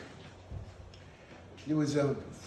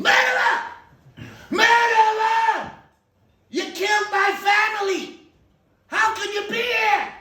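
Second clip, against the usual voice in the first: much higher, louder, slow drawn-out scream; screamed accusation; 12 dB louder, slower than usual. Retold or imitated. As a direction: scream at the murderer in the shul. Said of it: "freaked out and screamed".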